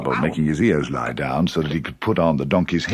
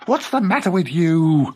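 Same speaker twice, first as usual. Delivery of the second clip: gasping Rabbit; 10 semitones higher than usual. A narrator's reading. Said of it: "gasped Rabbit".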